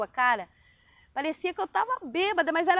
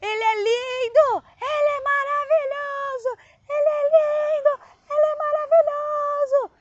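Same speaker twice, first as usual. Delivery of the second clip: high, louder, tearful cry of adoration; ecstatic crying shout; 12 semitones higher, 5 dB louder than usual. Retold or imitated. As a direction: cry it out high and loud, overcome with wonder. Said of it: "Eu comecei a gritar". (grito extasiado, agudo e choroso)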